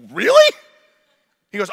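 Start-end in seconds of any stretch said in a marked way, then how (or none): 0.1-0.5 much higher, louder sarcastic yelp; mock disbelief. Pastor mocking his own reply to the salesman, squeaked. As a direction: squeak it in fake astonishment. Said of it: "we were like".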